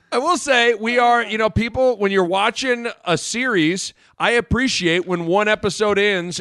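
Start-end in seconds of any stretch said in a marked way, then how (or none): none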